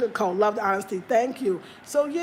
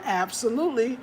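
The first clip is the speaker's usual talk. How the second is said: ordinary talk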